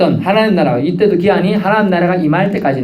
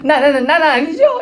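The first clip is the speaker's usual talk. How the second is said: higher, stuttering 나, 나, 나는 in a flustered rush; stammering denial; 12 semitones higher than usual. Retold or imitated. A frightened disciple denying betrayal, stammering higher. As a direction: trip over the first word in nervous panic. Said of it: "제가 그때 어감을 얘기하는 거예요".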